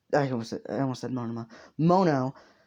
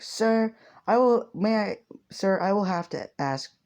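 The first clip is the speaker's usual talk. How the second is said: put-on higher, courteous young hero asking Six; polite hero voice, pitched up; 8 semitones higher, 3 dB louder than usual. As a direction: lift the voice into an earnest, overly polite hero. Hero addressing Mono formally.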